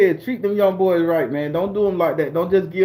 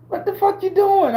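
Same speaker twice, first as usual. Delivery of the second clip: much higher, shocked outburst; stunned disbelief; 14 semitones higher than usual. Retold or imitated. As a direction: react as if you can't believe it. His stunned reaction retold.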